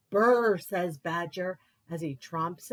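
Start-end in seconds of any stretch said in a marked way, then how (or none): 0.1-0.6 loud shivering brr; cold shiver. Acted.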